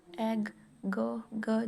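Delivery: very slow, drawing out एग and then ग; patient teacher sounding out a word. Shown as a demonstration. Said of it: "ये ऐसे बनेगा।".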